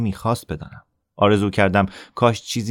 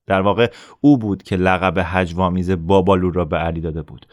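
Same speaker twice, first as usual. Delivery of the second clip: ordinary delivery, nothing marked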